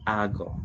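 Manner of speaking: ago said slowly and clearly; teacher pronouncing a Greek verb; Greek word demo. Shown as a demonstration. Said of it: "First word is".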